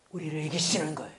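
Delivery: hushed landing after a fervent climax; sudden solemn hush. Real, not acted. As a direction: drop low and very soft. (갑자기 낮고 조용해지는 설교)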